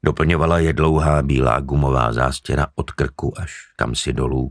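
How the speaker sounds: ordinary delivery, nothing marked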